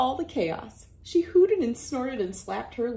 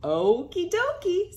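higher, sing-song okie dokie; cheerful agreement; 10 semitones higher, 4 dB louder than usual. Acted. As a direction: chirp it happily.

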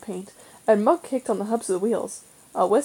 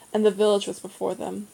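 usual speaking voice

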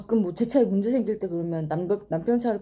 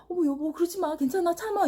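put-on high-pitched soothing; mock soothing wife; 9 semitones higher than usual. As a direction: imitate a wife gently calming her husband. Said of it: "이게 아니라".

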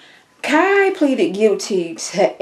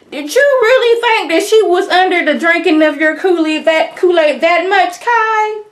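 sassy scolding; 8 semitones higher, 3 dB louder than usual. Retold or imitated. Viewer mocks Kai for trusting her, high sassy scolding. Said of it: "I'm like".

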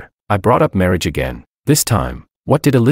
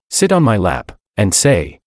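usual speaking voice

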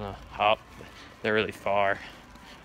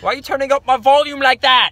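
put-on British accent=154; 13 semitones higher, 9 dB louder than usual. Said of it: "I sound like the guys from Kingsman".

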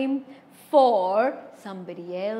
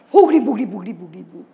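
spooky chant; 4 semitones lower, 7 dB louder, much faster than usual. Acted.

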